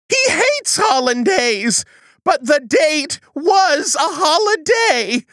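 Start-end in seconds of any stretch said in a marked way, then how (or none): none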